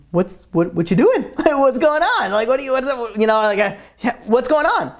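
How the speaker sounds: mildly incredulous, voice lifted; baffled. Retold or imitated. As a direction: lightly act a wife's disbelief. Wife finds sick husband dancing.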